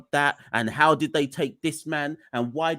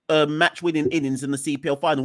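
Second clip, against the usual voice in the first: ordinary delivery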